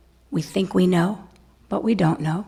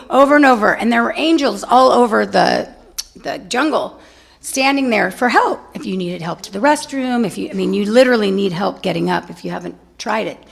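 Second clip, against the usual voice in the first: usual speaking voice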